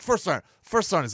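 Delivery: acted-out anxious summons; urgent calling. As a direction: call out for a superior. Retells urgent call to superior.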